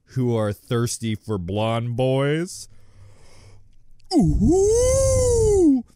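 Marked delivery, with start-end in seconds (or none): 4.1-5.8 loud high squeal of delight; much louder, high ooh; excited squeal. Real, not acted. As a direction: squeal like you love it. Thrilled by the ship.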